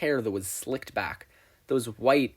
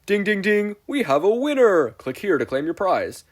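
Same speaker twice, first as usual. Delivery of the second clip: high, loud jackpot announcement; pop-up ad crowing a winner; game-show winner call; 9 semitones higher, 8 dB louder than usual. Acted.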